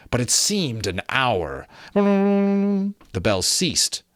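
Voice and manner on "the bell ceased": high, very slow, ringing out; drawn-out bell line. A narrator's reading. Narrator slowing as the bells stop, high and drawn out. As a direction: stretch it like a fading bell.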